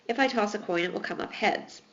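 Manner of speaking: ordinary talk, nothing marked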